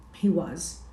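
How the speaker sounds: plain reading voice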